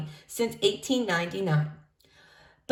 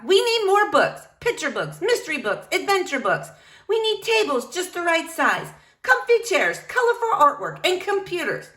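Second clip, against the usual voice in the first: excited librarian listing; 9 semitones higher than usual. Acted. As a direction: pile on the wishes eagerly. Rosalita lists her plans.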